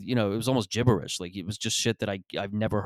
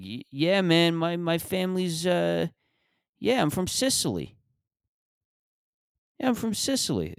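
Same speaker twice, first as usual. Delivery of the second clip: much higher put-on voice, hesitant; dopey high-pitched poser, stalling mid-claim; mock ancestry claimer; 9 semitones higher than usual. Guy named Hamish claiming to be from Sicily, high mock voice. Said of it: "And he's like".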